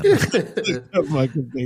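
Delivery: rueful laugh while admitting it. Real, not acted. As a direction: chuckle it out at yourself. Laughs at own mistake.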